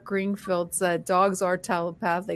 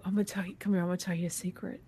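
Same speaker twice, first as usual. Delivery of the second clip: cupped hand whisper; 8 dB quieter, much faster than usual. Shown as a demonstration. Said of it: "I'll whisper".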